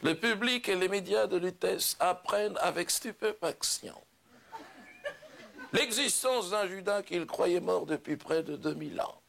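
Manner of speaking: slow, grandiose stage declamation; solemn proclamation. Acted. Judas revealing himself to the humans, solemn proclamation. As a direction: declaim it like a grand revelation, drawing out phrases.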